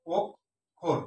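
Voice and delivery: অক্ষর as 'ok... khor', round o; correct pronunciation. Shown as a demonstration. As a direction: split অক্ষর between k and kh. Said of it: "এখানে সঠিক উচ্চারণ". (ওক্... খোর, ভেঙে উচ্চারণ)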